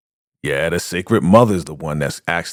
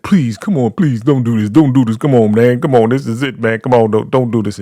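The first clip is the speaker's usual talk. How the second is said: urgent, louder mock begging; pleading; 4 semitones higher, 5 dB louder than usual. Condemned man begging them to stop, urgent mock pleading. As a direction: plead over and over, pitch raised.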